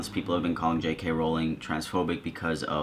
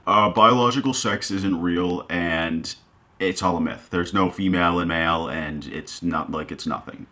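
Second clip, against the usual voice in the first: imagined radical activist; 3 dB louder than usual. Retold or imitated. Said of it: "sit here and say".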